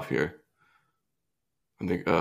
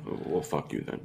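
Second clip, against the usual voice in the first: unvoiced whisper; whispered insult; 5 semitones lower, 12 dB quieter than usual. Real, not acted. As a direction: hiss it under your breath. Joking insult dropped low.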